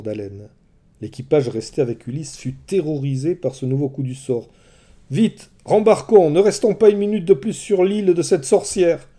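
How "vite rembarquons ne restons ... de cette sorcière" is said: high, loud, urgent begging; pleading. Acted. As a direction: beg them to flee the island. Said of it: "supplia Euryloque".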